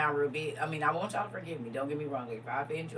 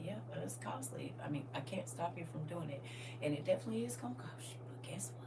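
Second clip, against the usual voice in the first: soft hushed whisper of a warning; God's voice, whispered; 9 dB quieter than usual. Retold or imitated. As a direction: breathe it as a quiet caution. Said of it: "He said".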